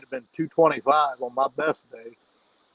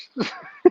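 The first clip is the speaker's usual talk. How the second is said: breathy laugh; 10 semitones higher than usual. Real, not acted. Laughing at end of story.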